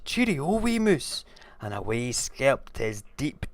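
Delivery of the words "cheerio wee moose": high-pitched goodbye; high alarmed toad voice; hasty frightened farewell. Acted. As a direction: call it out as you bolt.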